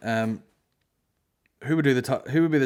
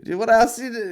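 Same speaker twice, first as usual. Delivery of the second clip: high, loud, excited Belfast bloke voice; mocking the bar guy; 12 semitones higher, 7 dB louder than usual. Comedian mimics a rude bar guy recognising him, high and loud. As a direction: ham up a nosy punter spotting a comic. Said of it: "and he".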